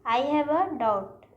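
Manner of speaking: I have a doubt drawn out slowly; teacher presenting the error slowly; slow wrong example. Shown as a demonstration. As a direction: read the mistake out slowly. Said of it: "गलत है".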